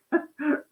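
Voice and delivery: quick high laugh at her own joke; a bright little chuckle; short laugh. Real, not acted. Joking about best sellers.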